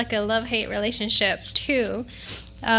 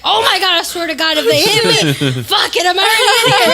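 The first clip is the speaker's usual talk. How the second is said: bracing to fight; 5 semitones higher, 6 dB louder than usual. Retold or imitated. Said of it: "And I was like".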